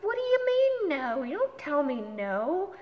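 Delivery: high, loud protest; indignant retort. Retold or imitated. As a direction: push back hard, outraged at being refused. Retold argument, refusing a no.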